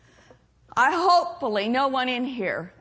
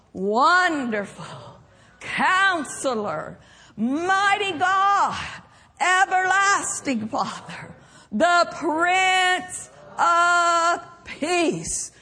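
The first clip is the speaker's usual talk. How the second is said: slow proclaimed names, rising in pitch; leading a recitation; 3 semitones higher, much slower than usual. Real, not acted. Preacher leading the church in reciting the names of Christ. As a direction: lead the congregation through each name. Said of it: "say it with me".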